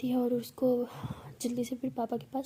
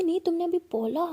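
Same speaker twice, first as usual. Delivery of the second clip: insistent; 5 semitones higher, 5 dB louder than usual. Acted. Pihu insists.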